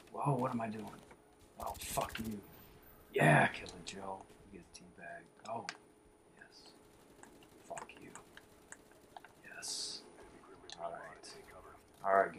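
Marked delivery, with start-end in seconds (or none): none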